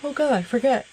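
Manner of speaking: said through a yawn. Real, not acted. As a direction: yawn mid-sentence. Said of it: "that was a rude yawn".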